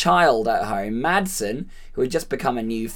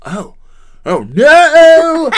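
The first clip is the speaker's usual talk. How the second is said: small oh, then a huge high yelled oh no; over-the-top Nick Cage; 13 semitones higher, 13 dB louder, much slower than usual. Retold or imitated. Nick Cage overacting the line for the director. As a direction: blow the line up into a wild scream. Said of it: "for Nick Cage, it would be".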